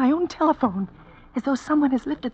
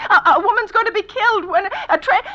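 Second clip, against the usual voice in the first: higher and louder, building to near hysteria; rising panic; 6 semitones higher, 4 dB louder than usual. Acted. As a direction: spiral into panic. Panicking invalid on phone.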